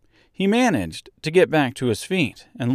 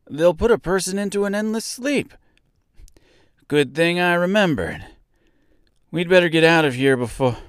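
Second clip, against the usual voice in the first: high, urgent character voice; anxious to get away; alarmed warning; 7 semitones higher than usual. A narrator's reading. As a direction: pipe up with rising worry. Scarecrow warns of endless sleep.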